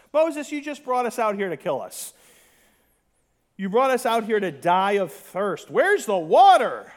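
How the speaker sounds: building to a loud, demanding whine; mock angry complaint. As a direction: hammer Moses with the complaint. Acting out thirsty grumbling crowd.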